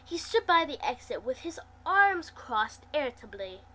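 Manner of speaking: ordinary delivery, nothing marked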